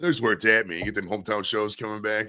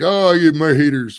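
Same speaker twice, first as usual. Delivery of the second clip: mock whine; 6 semitones higher, 10 dB louder, slower than usual.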